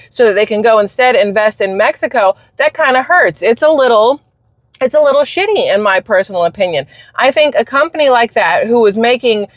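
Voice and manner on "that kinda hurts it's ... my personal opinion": normal talking voice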